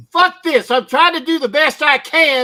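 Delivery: frustrated outburst. Real, not acted. Frustrated over his failing accent.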